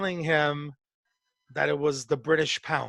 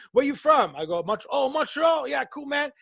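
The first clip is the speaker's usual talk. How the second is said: mock chatty New Yorker; 6 semitones higher than usual. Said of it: "you tell people".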